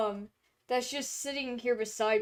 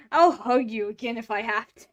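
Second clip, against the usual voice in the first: louder, teasing; playful louder threat; 9 dB louder than usual. Real, not acted. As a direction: say it louder, half-joking threat. Teasing the cat with hugs.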